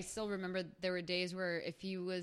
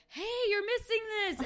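calling out; 14 semitones higher, 6 dB louder than usual. Retold or imitated. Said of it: "you would hear someone be like".

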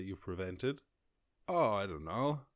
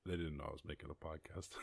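ordinary delivery

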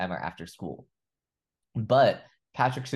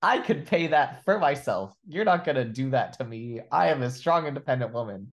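higher and louder, mock indignant strong woman; mock sassy retort; 5 semitones higher, 4 dB louder than usual. Retelling Spike refusing Patrick's ice cream, mock defiant. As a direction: play up a defiant brush-off. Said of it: "she's like".

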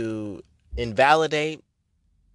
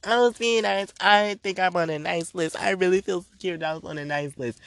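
naive self-assured voice; 6 semitones higher than usual. Retold or imitated. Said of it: "you thought".

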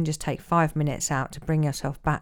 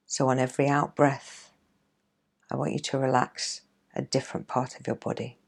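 guided meditation; 3 dB quieter than usual. Acted. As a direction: ease them into calm, gently. Leads a guided relaxation.

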